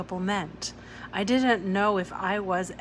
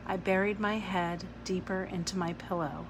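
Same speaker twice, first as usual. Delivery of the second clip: ordinary delivery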